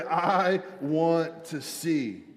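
each word stretched out; slow, deliberate. Shown as a demonstration. Preacher prompting neighbours to repeat, slow and deliberate. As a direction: spell it out slowly for others to repeat.

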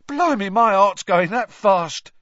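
high, loud cockney lad, mocking; Stan's voice. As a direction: tease him like a cheeky bus conductor. Stan shaken.

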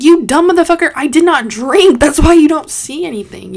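loud, high-pitched retort; angry outburst. Retold or imitated. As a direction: reenact yelling indignantly at a cop. Retold angry outburst at cop.

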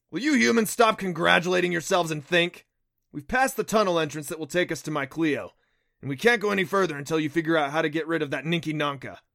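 exasperated scolding. A narrator's reading. Neff scolding humans for celebrating, exasperated. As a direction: scold them loudly, speeding up at the end.

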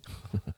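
small, quiet sad chuckle; sad laugh. A narrator's reading. Father talking about his life, laughing sadly. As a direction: let out a brief, rueful laugh. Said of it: "He laughed sadly".

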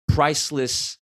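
usual speaking voice

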